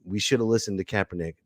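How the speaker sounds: soft, quick grudging admission; sheepish mumbled regret. Retold or imitated. The NFL admitting Kaepernick was right, muttered. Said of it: "the N F L is like".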